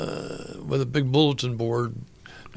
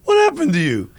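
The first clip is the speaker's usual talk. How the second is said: amazed praise; 7 semitones higher, 6 dB louder than usual. Retold or imitated. Teacher amazed by his playing.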